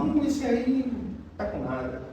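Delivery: scornful hum then a soft dismissal; mock dismissive. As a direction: sniff at a plain-speaking pastor. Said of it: "a pessoa normal fala normal".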